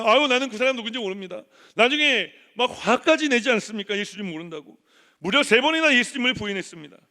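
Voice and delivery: loud, high, driving sermon delivery; preaching at full force. Acted. Pastor preaching hard to the church, loud and urgent.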